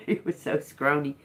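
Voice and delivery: ordinary talk, nothing marked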